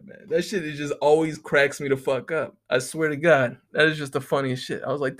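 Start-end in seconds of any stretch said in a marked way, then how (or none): none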